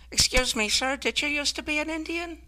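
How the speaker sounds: mock a wide-eyed ten-year-old; hammed-up sweet little girl. Little girl questions cashier.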